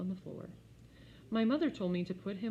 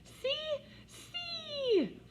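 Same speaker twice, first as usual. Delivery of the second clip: put-on giggling toddler. A narrator's reading. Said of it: "Fudge laughed".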